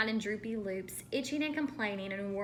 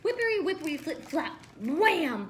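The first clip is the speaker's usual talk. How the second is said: excited; 7 semitones higher, 5 dB louder than usual. A narrator's reading. Sound words of the fall.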